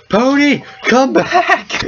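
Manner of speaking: high, loud comic holler after someone; desperate yell. Acted. Fallen rider calling after his runaway horse, high comic yell. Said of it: "and he yells".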